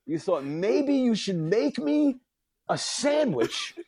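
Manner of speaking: hammed-up furious tirade; retold rant. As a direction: tear into him, outraged. Ranting at deli guy.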